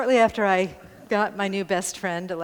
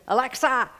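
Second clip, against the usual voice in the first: higher, louder parrot squawk; parrot calling; 5 semitones higher, 5 dB louder than usual. Retold or imitated. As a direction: call her name like a parrot. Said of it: "He went".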